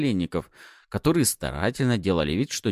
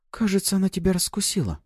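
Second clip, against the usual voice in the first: whispering; 11 semitones higher, faster than usual. Acted. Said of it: "прошептала Пафи".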